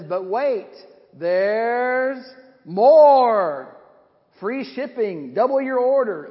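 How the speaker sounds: loud, rising infomercial announcer; TV ad pitch. Retold or imitated. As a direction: sell it like a late-night TV pitchman. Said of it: "it says".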